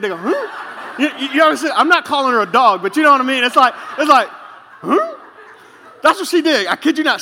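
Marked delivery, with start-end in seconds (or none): 0.2-1.0 high-pitched questioning yelp; puzzled dog "huh?". Retold or imitated. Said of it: "they kinda tilt their head". 4.8-5.2 very high questioning squeak; puzzled dog "huh?". Retold or imitated. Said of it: "they kinda tilt their head".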